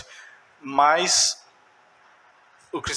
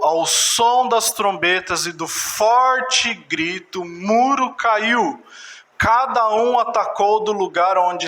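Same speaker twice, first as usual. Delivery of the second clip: raised, ringing Bible reading; forceful scripture reading; 5 semitones higher, slower than usual. Acted. Preacher reads Jericho's fall to the church, forceful. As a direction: read the verse out higher, building to the fall.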